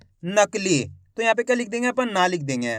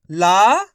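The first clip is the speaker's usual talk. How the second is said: ला called out loud with a long आ; loud syllable demo; 7 dB louder than usual. Shown as a demonstration. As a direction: stretch ला and say it loud. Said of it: "ला का उच्चारण यहाँ पे खींच को रहा". ("ला" को ज़ोर से खींचकर बोलें)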